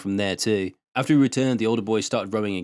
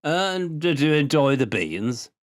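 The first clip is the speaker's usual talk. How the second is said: put-on high, teasing old man's voice; old farmer; 5 semitones higher, much slower than usual. A narrator's reading. Old Liu scolding the boys for stolen beans, teasing old voice.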